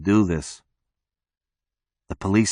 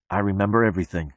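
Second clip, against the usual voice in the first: ordinary delivery, nothing marked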